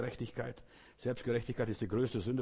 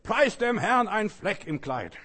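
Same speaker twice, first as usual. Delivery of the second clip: much higher and louder, slow booming praise; loud forced praise; 10 semitones higher, 10 dB louder, slower than usual. Retold or imitated. Pastor booming praise over the stained dress, loud and slow. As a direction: belt out praise through clenched anger. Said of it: "Dann sagt er ganz laut".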